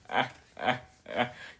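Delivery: rhythmic punched-out laugh; mock bragging laugh. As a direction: laugh in separate, showy bursts. Retold bragging laugh to friend.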